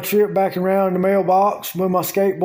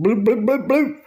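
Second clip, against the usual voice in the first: gulping sound; 5 semitones higher than usual. Retold or imitated. Imitating gulping beer.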